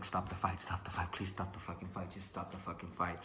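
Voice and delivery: very soft, low desperate muttering; pleading under breath. Retold or imitated. Exhausted boxer as doctor checks opponent, hushed pleading. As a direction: beg quietly, almost to yourself. Silently begging doctor to stop fight.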